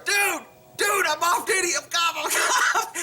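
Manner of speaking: high-pitched, mock-panicked calling out; retold urgent shout. Off-duty cop running over to officer, retold urgent shout. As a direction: call out to stop him, high and laughing by the end.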